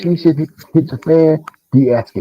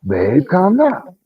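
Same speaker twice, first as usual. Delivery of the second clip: slow, raised-pitch mock soothing; de-escalating plea; 6 semitones higher, much slower than usual. Man re-enacting the calm one mid-argument, slow high plea. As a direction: stretch it out as a patient plea. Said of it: "I'm tired of being the calm one".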